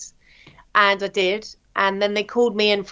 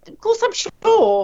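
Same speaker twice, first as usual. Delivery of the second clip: indignant insistence; 12 semitones higher, slower than usual. Retold or imitated. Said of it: "I said".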